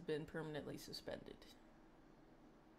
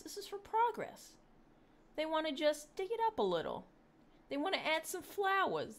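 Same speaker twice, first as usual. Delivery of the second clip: higher, sing-song sarcastic; mock innocence; 7 semitones higher than usual. Streamer mocking the protesters with sarcastic innocence. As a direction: play innocent with heavy sarcasm.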